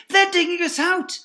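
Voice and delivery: high loud alarm shout. Acted. Fox waking his family, loud alarmed shout. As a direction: yell to wake the family. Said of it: "he shouted".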